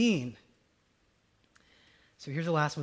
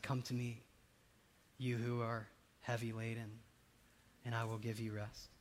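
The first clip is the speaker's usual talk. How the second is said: soft, much quieter reassurance; gentle Jesus; 7 semitones lower, 12 dB quieter than usual. Retold or imitated. Jesus gently reassures the weary believer, soft and tender. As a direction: hush it like a comfort.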